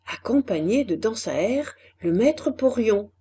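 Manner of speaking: usual speaking voice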